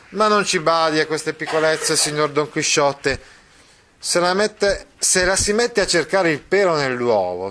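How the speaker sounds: Mastro Pietro replying. Acted. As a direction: brush off the fuss. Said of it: "smise di scampanare e disse". (Mastro Pietro che minimizza, voce più alta)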